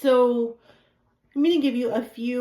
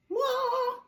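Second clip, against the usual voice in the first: high yelp. Pretend. Mock buzzer for wrong answer.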